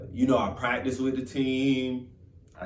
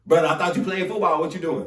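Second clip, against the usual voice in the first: mock teasing; 5 semitones higher, 5 dB louder, faster than usual. Jokers teasing in the caf.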